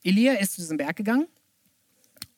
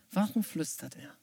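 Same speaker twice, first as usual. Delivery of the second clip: much softer, quietly puzzled; hushed questioning; 3 semitones lower, 8 dB quieter than usual. Shown as a demonstration. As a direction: drop to a soft question. (leise fragend)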